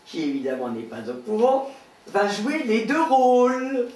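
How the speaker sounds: presenter introducing a scene, pitch swinging up; stage announcer. Acted. Host introducing the final sketch, announcer voice. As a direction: announce it to the audience, drawing out the end.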